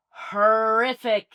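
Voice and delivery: HORRIFIC drawn out, high and loud; emphatic disgust. Real, not acted. Host griping about Irish accents on screen, "horrific" stretched emphatically. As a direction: lean hard and long on "horrific".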